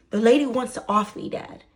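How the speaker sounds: loud, emphatic retold plea; exasperated insistence. Daughter insisting to her dad, loud exasperation. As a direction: push the first words hard, then drop off on Dad. Restates it to dad, less shouted.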